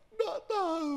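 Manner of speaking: high, tearful whine; crying woman. Retold or imitated. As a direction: sob it out helplessly. (suara ibu menangis tinggi)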